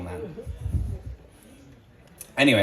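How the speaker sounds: ordinary delivery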